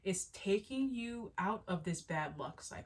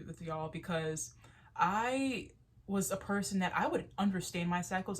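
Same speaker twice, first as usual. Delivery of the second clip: ordinary delivery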